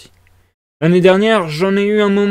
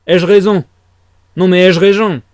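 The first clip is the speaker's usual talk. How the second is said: louder, insistent demand for agreement; shouting; 2 semitones higher, 5 dB louder than usual. A narrator's reading. Drunk student demanding the women agree, loud and insistent. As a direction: cry it out, shaking their hands. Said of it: "cria Razumikine".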